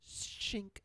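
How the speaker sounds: unvoiced high shink of a blade; knife sound. Husband imitates the knife unsheathing, a whispered shink. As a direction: make the blade slide out. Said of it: "he goes like this".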